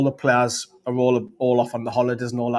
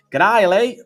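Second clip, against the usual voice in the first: loud, high-pitched, hammed-up Aussie greeting; badly faked Australian twang; awful Australian accent; 9 semitones higher, 8 dB louder than usual. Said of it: "Oh, that's an awful accent".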